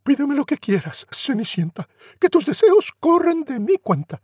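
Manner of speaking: sprightly godmother; fairy godmother. Acted. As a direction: grant her wish grandly.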